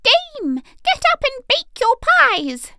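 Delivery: shrieking rooster voice, high and loud; loud cock crow. A narrator's reading. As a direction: crow it out like a rooster waking the town. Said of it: "First and loudest the cocks cried out".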